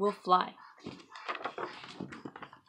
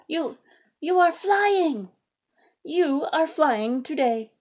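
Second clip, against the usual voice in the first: higher, slow and wondering; amazed; 8 semitones higher, 9 dB louder, much slower than usual. Acted. Elephant amazed Piggy is flying, higher and slow. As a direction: marvel at it slowly.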